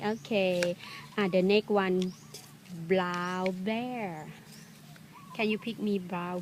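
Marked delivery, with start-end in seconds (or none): none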